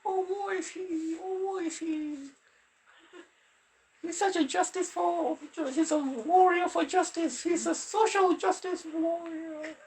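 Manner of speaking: mock pity in a pushed-up high register; strained falsetto-ish sarcasm. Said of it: "I feel my voice is strained".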